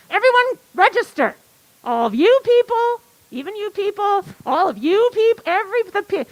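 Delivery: high, insistent appeal to the crowd; rallying call. Real, not acted. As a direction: call out to every last person. Rallying the whole audience to register.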